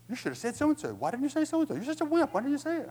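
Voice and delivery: high, loud inner nagging; mock tirade at oneself; acted self-scolding. Acts out berating oneself after arguing.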